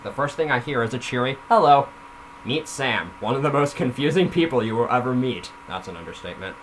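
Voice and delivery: ordinary delivery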